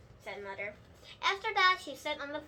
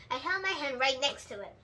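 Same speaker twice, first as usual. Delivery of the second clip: ordinary delivery, nothing marked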